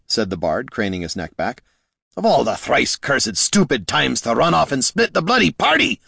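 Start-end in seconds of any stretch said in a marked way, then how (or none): 2.2-6.0 high, exasperated cursing; irate, raised-pitch grumble; snorting exasperation. A narrator's reading. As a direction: rant about a missing companion. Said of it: "Gorm snorted".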